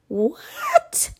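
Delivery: be utterly baffled by the question; incredulous what. Acted. Hannah hears her husband's silly question, squeaking in disbelief.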